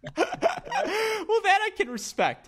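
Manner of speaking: can barely get it out for laughing. Real, not acted. Laughing at the co-host's phone excuse.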